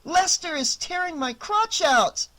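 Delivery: high, loud shriek. A narrator's reading. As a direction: screech it in pain and outrage. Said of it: "Annie shrieked".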